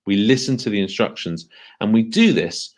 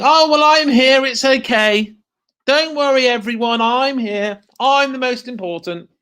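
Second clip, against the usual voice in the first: put-on high, cocky voice of a self-important kid; smug child; 4 dB louder than usual. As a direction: play the show-off who thinks he matters most. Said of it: "And you may think".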